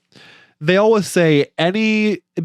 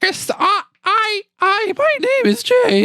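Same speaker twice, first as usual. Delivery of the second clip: put-on very high squeaky little kid; joking around in a high child voice.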